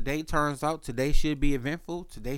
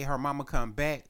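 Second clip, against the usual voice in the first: ordinary talk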